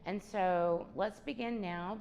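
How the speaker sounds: normal talking voice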